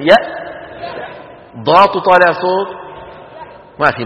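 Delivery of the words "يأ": يأ with a sharp glottal catch; held hamza demo. Shown as a demonstration. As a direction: close the throat on يأ. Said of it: "قول: يأتون". (يأ بهمزة شديدة محبوسة)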